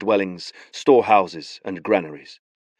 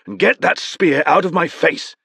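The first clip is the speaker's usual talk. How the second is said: irritated order; 9 semitones higher, 4 dB louder than usual. Acted. Beron telling old man.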